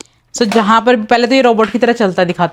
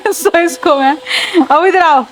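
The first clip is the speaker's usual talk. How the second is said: higher, slow giggly words; laughing; 5 semitones higher, slower than usual. Real, not acted. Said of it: "इसलिए मुझे हंसी आ रही है". (बच्चे की ख़ुशी पर हँसते हुए बोलना)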